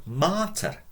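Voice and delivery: martyr with a long open A. Shown as a demonstration. Teacher giving Latin for mother, long A in martyr. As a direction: draw out the first vowel of martyr. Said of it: "With a long A".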